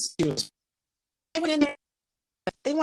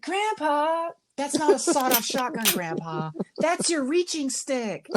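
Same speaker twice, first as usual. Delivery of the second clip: much higher, slow scolding voice; mock scolding grandkid; 10 semitones higher than usual. Kid corrects grandpa about his gun, high and slow. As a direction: talk down to grandpa like a small child. Talking down to elderly biker.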